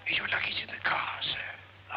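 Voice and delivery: unvoiced whisper back. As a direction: whisper along with the boss. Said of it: "What are you whispering about?".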